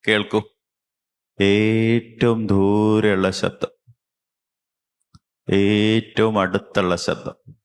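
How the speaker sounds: slow, drawn-out meditation voice; guided meditation. Real, not acted. Teacher guiding children to listen with eyes closed, slow guided meditation. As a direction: stretch the key words slowly, with long pauses.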